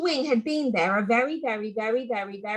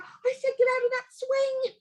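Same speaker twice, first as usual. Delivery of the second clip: much higher insistent voice; high-pitched warning; 13 semitones higher than usual. Acted. Sister warning her brother off the swing, high and pleading. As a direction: plead high and firm, scared. Sarah more worried.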